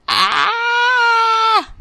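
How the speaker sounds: long, loud, high-pitched scream; high mock scream. Mock scream at returning gun.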